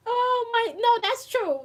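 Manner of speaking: usual speaking voice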